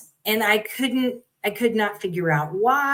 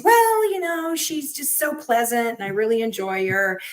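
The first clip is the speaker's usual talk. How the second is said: high, sugary imitation of the teacher; mock the teacher's sweet excuse, pitched up; imitating a teacher cooing over a student; mimicked teacher voice; 4 semitones higher than usual.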